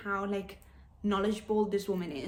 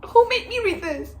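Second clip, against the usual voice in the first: louder, higher, tearful pleading; 3 semitones higher, 7 dB louder than usual. Real, not acted. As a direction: cry through the words. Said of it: "There's tears on the page".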